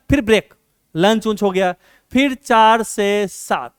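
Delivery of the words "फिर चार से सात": slow, words stretched apart; drawn-out dictation. Real, not acted. Teacher noting the next study slot after lunch, slow drawn-out dictation. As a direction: say it at writing speed.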